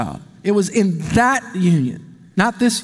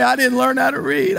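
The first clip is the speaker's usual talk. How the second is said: put-on whiny child; 4 semitones higher than usual.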